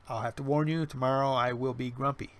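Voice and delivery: ordinary delivery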